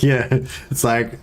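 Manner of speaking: ordinary talk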